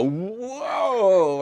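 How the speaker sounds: high, loud stagey whoa; hammed-up startled whoa; actor's whoa. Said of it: "they all go".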